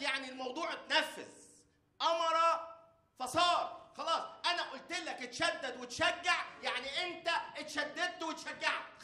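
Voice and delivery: loud, high, slow preaching at full force; preacher thundering God's command to the church; fiery preaching. Acted. The preacher pounds the command to the congregation, loud and high.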